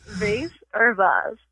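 slowly "Vase" as vayss, then vahz; two pronunciations. Shown as a demonstration. Host quizzing a guest, "Vase" said both ways. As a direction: offer "Vase" rhyming with face, then vahz. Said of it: "I have a pronunciation test".

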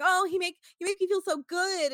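high, loud swooning coo. Retold or imitated. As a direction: coo it, overwhelmed with pleasure. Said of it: "she's like".

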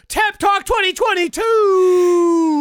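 slow high shouted show name; shouted title. Acted. Host announces the podcast title, shouting slowly.

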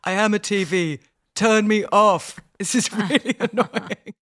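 a hint of a grumpy mother, breaking into a laugh; mock-annoyed mother. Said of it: "And she was like".

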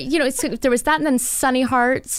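ordinary talk

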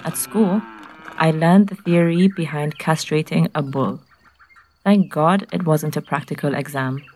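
ordinary delivery, nothing marked

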